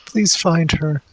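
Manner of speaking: high, slow, tearful begging; pleading. Acted. Mother grabs a stranger by the collar, pleading. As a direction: plead desperately in a high weepy voice. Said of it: "like, pleading".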